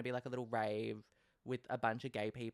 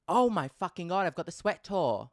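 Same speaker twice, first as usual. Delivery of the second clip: excited outburst; 6 semitones higher, 8 dB louder than usual. Real, not acted. Sudden excitement.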